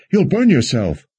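high-pitched urgent warning cry; alarmed cry. A narrator's reading. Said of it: "Alessandra cried".

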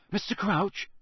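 cautious. A narrator's reading. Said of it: "said Harry cautiously".

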